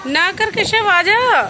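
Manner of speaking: calling out. Real, not acted. Mother calling Keshav back from across the yard, loud high call. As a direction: call him back firmly from afar.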